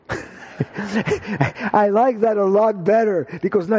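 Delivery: high, delighted words breaking out of a laugh. Real, not acted. Preacher tickled by a friend's pebble-in-the-shoe line, laughing.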